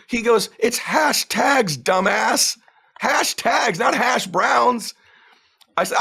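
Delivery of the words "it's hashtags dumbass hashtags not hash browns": high, slow, spelled-out retort; exasperated correction. Retold or imitated. Coach corrects a beginner who heard hash browns, exasperated. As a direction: drag out each word as if to a fool.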